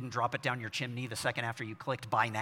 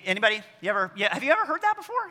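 ordinary talk, nothing marked

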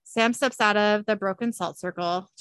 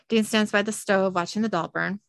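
normal talking voice

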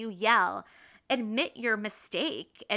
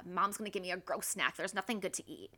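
put-on softer, fast grumble of a disappointed child; discouraged kid; 2 semitones lower, 7 dB quieter, faster than usual. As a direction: imitate a kid sulking under their breath. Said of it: "they go to negative thoughts like".

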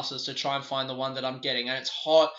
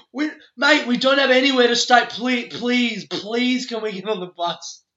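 desperate pleading; 8 semitones higher, 9 dB louder than usual. Retold or imitated. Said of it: "the guy was like".

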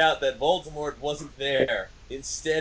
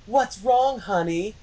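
high, louder sweetheart voice; hammy lilting concern; concerned boyfriend; 7 semitones higher, 4 dB louder than usual. A narrator's reading. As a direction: ask it as a doting boyfriend, pitched up. Boyfriend voiced, consoling crying lover.

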